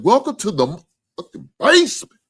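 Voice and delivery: loud, high, hyped-up shout; whooping welcome into the basement; Trucker's big welcome. Retold or imitated. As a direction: belt it like a host welcoming guests. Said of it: "My man Trucker said".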